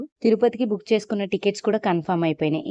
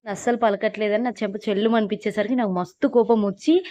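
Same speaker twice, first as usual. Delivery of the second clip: ordinary talk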